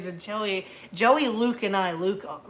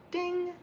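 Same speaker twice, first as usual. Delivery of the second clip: mock ding; 10 semitones higher, 6 dB quieter than usual. Notification sound after mod message.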